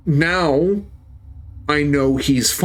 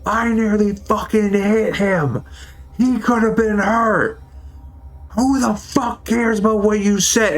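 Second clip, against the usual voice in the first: higher-pitched angry yell, replayed; half-restaged screaming match, pitched up; retold furious yelling; 8 semitones higher than usual. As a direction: hurl it back at the coworker, furious. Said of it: "I started screaming at my coworker".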